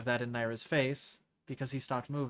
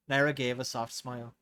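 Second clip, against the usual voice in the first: ordinary delivery, nothing marked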